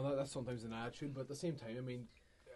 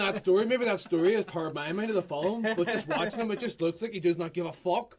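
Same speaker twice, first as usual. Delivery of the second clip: angry rant; 8 semitones higher, 14 dB louder than usual. Real, not acted. Ranting about Brando's performance.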